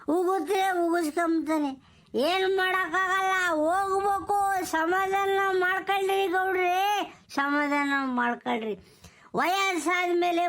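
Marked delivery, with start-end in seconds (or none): none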